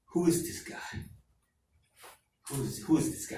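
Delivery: put-on halting African accent, puzzled. African coworker asks who [name] is, African accent. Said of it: "African guys, too, from Africa".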